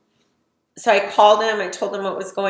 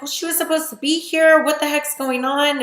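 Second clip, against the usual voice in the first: raised, higher, exasperated; frustrated outburst; 6 semitones higher, 3 dB louder than usual. Retold or imitated. On the phone to grandma, upset mom isn't there, exasperated and raised. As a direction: let the frustration burst out at grandma.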